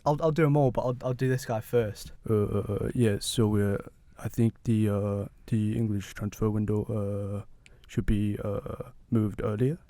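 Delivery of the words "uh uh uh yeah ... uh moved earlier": Arsène Wenger impression. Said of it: "meant to be Wenger".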